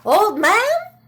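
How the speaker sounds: put-on high, loud old woman calling; a lightly voiced old woman addressing her husband. Old woman calls to her husband, high and loud.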